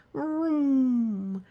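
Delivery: loud high vroom; playful engine noise. Acted. Enormous elephant sound.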